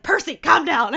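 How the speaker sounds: loud, high call to a needy dog. Retold or imitated. Investigator calling his dog to calm down, loudly. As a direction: raise the voice and tell the dog to settle. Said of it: "he's like".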